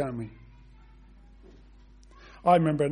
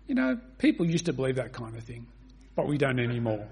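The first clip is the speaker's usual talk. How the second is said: imitating a condescending friend, lower-pitched; 6 semitones lower, 3 dB quieter than usual. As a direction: talk down to them in a low, dismissive voice. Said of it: "gave me that condescending look and said".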